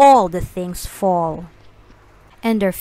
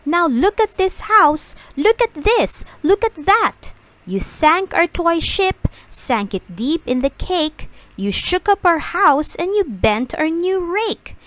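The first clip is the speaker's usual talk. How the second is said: fish scolding; 7 semitones higher than usual. A narrator's reading. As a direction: tell the cat off, higher. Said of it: "said the fish to the cat".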